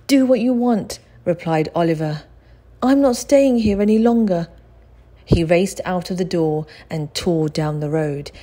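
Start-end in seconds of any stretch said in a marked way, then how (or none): 0.1-4.5 higher, louder defiance; boy refusing to stay, higher and louder; defiant boy. Acted. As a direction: throw it back and go. Oliver defiant, leaving.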